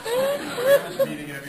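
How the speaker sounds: high, loud, slow laugh-through. Real, not acted. Laughing hard while telling the story, high.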